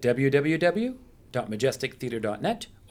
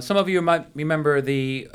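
usual speaking voice

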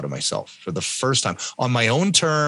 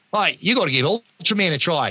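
higher-pitched imitation of an Australian pal; hammed-up Australian mate; Australian accent; 7 semitones higher than usual. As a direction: put on an amateur Australian accent. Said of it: "I won't do the Australian accent".

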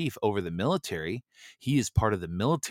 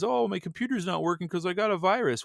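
mock panicked user; 9 semitones higher than usual. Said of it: "people get freaked out because".

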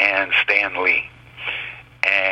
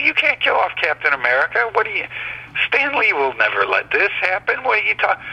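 very high, quick-fire disbelief; retold outrage, voice pitched way up; incredulous protest; 12 semitones higher, faster than usual. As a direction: protest as if it cannot possibly be true. Retold protest at killing a hero.

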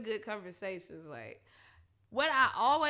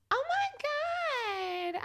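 drawn-out exclamation; 13 semitones higher, much slower than usual. Real, not acted. Exasperated exclamation.

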